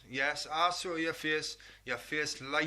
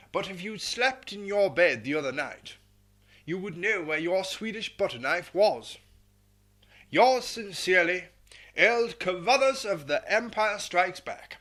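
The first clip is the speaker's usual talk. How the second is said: put-on louder, pompous old colonel reading his letter; Carruthers voice; 3 dB louder than usual. Host reads Carruthers's letter in a louder pompous colonel voice. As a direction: ham up the blustering officer. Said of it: "I'll never be able to stop that voice".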